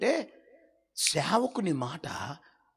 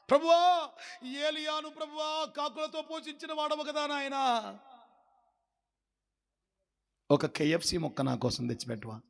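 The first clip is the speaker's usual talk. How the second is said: mock pleading prayer; 8 semitones higher than usual. Mock prayer of greedy believer.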